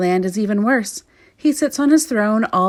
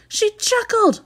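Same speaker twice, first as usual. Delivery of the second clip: wailing king; 14 semitones higher, slower than usual. Acted. Complaining to the soldier about her chuckle, high wail. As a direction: whimper it like a spoilt child. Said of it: "wailed the king".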